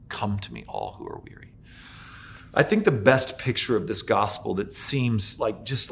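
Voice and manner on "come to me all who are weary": very soft, much lower whisper; Jesus whispering to the weary; whispered invitation. Acted. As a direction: barely breathe the call. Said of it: "when he says".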